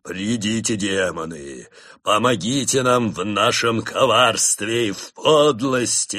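a monk calling demons over the altar, theatrical; summoning cry. A narrator's reading. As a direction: intone the demon call grandly. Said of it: "воскликнул".

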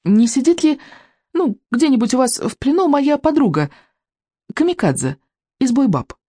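a hint of a hesitant, inquiring youngster; lightly voiced Икинг. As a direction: ask hesitantly, pitched a little up.

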